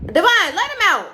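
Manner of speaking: calling to someone in another room, a loud real call. Real, not acted. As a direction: shout through the house to get someone to open the door.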